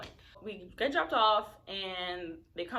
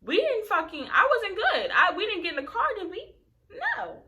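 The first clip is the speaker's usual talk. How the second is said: exasperated laughing rant; 12 semitones higher, 9 dB louder than usual. Real, not acted. Furious rant about stranding.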